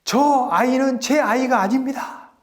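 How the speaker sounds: acted high-pitched desperate begging; wailing plea. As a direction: beg as a mother for her baby's life, pitched high throughout. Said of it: "하면서 막 울부짖는 거였습니다".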